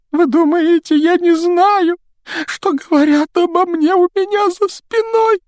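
very high, loud, drawn-out weeping ghost; shrieking tearful ghost girl; wailing self-pity. Acted. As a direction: wail the insults through tears. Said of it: "заливаясь слезами".

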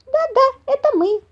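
very high, eager mouse squeak; squeaky little mouse. A narrator's reading. Climbing mouse confirming to mother bear, squeaky. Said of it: "подтвердил лазающий мышонок".

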